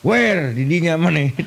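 normal talking voice

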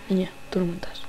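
whisper. Acted. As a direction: breathe it into her ear. Repeats it whispered. (susurro amenazante)

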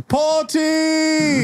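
very high, stretched cheer; party cheer. Acted. Characters celebrate found treasure, high party whoop.

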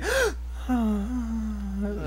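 breathy hammed-up sigh; soft drawn-out dramatic sigh; dramatic gasp-sigh. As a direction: act out the sigh big. Mock dramatic sigh for story.

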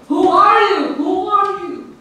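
high, loud call across a valley; high-pitched demanding yell; shouting back. A narrator's reading. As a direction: shout the challenge, then ease off on the repeat. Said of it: "he shouted back".

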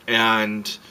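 ordinary talk, nothing marked

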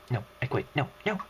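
ordinary delivery

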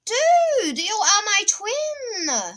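high, slow thrilled exclaim; excited. Real, not acted. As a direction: shout with joy at a kindred player. Thrilled opponent plays like him.

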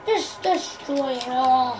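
playful low, drawn-out threat; low mock menace. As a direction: sink into a quiet villain's order. Same cry repeated low.

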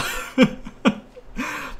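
high, wheezy burst of laughter. Real, not acted. Gamer laughing at wrestling's worst stinkers, amused laugh. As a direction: laugh it out helplessly. Reacts to bad storylines.

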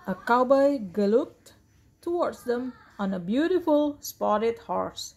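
ordinary delivery

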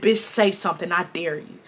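low, quiet dare; retold threat, energy held back; calm voice. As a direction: dare them softly and calmly. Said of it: "I'm just saying it in a calm voice".

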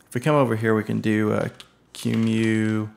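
normal talking voice

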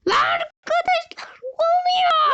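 high crying yell to the viewers; upset shout. Real, not acted. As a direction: wail to the audience in outrage. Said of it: "Ne bağırıyorsun?". (ağlamaklı bağırış)

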